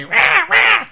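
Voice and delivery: two loud, very high mock screeches; pterodactyl screech. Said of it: "like a fucking pterodactyl".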